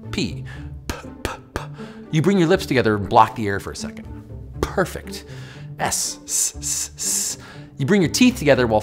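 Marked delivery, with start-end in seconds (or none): 0.1-1.6 P said as a bare lip pop; P sound demo. Shown as a demonstration. 5.8-7.4 S hissed as a long unvoiced s; S sound demo. Shown as a demonstration. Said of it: "forcing air out".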